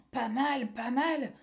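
put-on mildly bored child, slightly high; yawning. Boy rates the insults, yawning. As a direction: say it through a light yawn. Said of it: "dit Pierre en bâillant".

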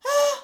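put-on short high loud yelp of a startled child; startled gasp. Boy wakes facing the witch, startled cry. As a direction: jump awake with a loud "Ah!". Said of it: "s'écria Pierre en sursautant".

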